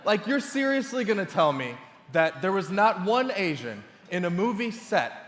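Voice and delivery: comic disbelief. Acted. Comic disbelief at missing representation.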